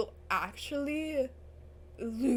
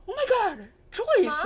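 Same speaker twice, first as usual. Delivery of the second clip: loud, higher cry of alarm; horrified cry; 7 semitones higher, 10 dB louder than usual. Real, not acted. Viewer seeing Joyce collapsed, horrified loud cry. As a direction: cry out her name in horror.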